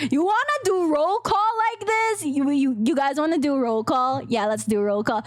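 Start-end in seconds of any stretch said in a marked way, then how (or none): 0.1-2.2 raised voice. Pretend. Playful announcer offer for roll call.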